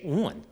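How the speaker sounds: "on" with a rounded vowel, like "awn" or "won"; Maryland "on". Shown as a demonstration. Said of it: "In Maryland, we like to say".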